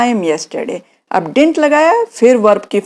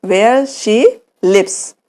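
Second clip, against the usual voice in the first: slow correct form; much slower than usual. Shown as a demonstration.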